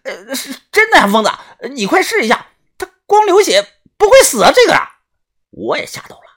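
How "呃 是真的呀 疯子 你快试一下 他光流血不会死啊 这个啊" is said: 高声慌乱地喊; 吓得语无伦次，嗓音拔高. A narrator's reading. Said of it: "眼神惊恐啊". (惊恐地尖声催促)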